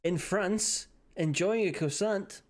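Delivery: playful mock accent of someone in France. Streamer announcing Steen in France with a croissant, put-on France accent. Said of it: "I do a certain accent when I'm in France".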